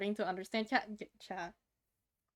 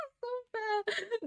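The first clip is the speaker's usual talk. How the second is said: much higher, slow, laughing "It's so fat"; laughing squeal; 11 semitones higher, slower than usual. Real, not acted. Laughing at cute drawing.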